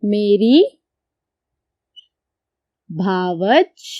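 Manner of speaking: dictating मेरी भावज; dictation pace. Shown as a demonstration. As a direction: spell it out slowly for the class to write. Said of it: "मैं लिखती हूँ".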